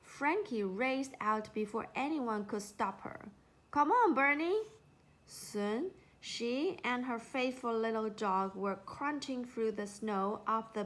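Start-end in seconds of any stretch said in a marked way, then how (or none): 3.7-4.6 louder, higher call to the dog. A narrator's reading. Calling her dog.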